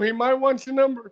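singsong teasing repeat; mock teasing voice. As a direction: rib your friend in a playful put-on voice.

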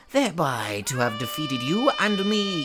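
normal talking voice

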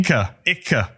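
"icke" said as two syllables, hard k; Berlin icke. Shown as a demonstration. Host shows listeners the Berlin "icke", hard k. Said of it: "So he says".

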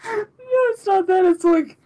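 high, teary laughing voice; fighting back laughter tears; laughing-crying. Real, not acted. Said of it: "you need to stop laughing".

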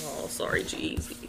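soft whispered sorry; whispering. Pretend. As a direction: whisper a fake condolence. Mock condolence for a killed pick.

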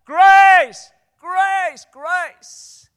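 high shout that fades over each grace; shouted then fading. Retold or imitated. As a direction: start with a huge cry of grace, then let it die down. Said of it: "and they say".

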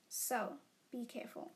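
unvoiced, teasing warning; playful whisper. Real, not acted. Warning viewers not to clap back, whispered. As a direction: breathe it like a secret threat.

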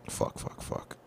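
voiceless, under-the-breath swearing; frustrated whispered swearing; muttered curses. Real, not acted. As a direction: hiss the curses quietly to yourself. Frustrated, can't remember the title.

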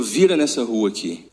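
low, soft voice of God; a quiet, low divine instruction. Retold or imitated. God speaks to him in car.

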